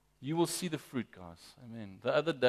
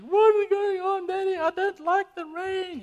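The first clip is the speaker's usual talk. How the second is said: put-on upset three-year-old's wail, very high; Abby; 7 dB louder than usual. Father imitates his upset daughter at a rainy party, wailing. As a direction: imitate a small child whining loudly, far higher. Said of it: "Abby was upset, guys. She was saying".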